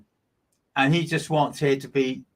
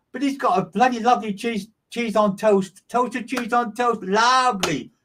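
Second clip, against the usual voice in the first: higher, delighted rave about cheese on toast; gushing spirit quote; 9 semitones higher than usual. Retold or imitated. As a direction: relay his delight, voice lifted high. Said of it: "beautiful, he says".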